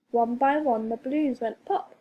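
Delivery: ordinary delivery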